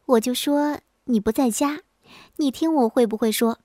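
ordinary delivery, nothing marked

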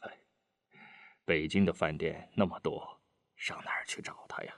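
low, sighing helplessness; wry resignation. A narrator's reading. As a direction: fade almost to a whisper, ruefully resigned. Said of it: "德武苦笑了一下". (苦笑着低声叹气)

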